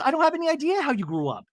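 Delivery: high-pitched, quick retort; mock indignation. Castmate retorting in a retold quarrel, high mock indignation. Acted quarrel between Brittany and Mary.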